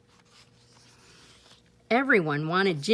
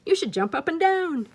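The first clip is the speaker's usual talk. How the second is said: frog; 10 semitones higher, 6 dB louder than usual. Acted.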